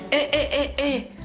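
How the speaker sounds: high, fast nervous fox. Acted. Fox defends himself.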